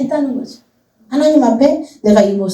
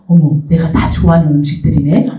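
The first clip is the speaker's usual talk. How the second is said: amused aside; 6 semitones lower than usual. Retold or imitated. Preacher's own amused reaction.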